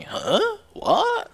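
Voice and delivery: high, baffled squeak; squeaky puzzled guard voice; clueless soldier. Retold or imitated. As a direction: play a dim soldier totally confused. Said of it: "they would just sit there going".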